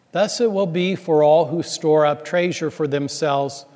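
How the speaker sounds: ordinary talk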